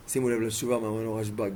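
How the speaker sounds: ordinary delivery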